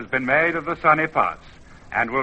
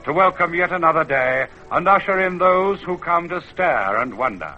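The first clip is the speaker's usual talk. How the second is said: spirit of the mountain; 7 semitones lower than usual. Acted. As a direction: speak as the old mountain watching over the land. Mountain spirit narrates the dawn.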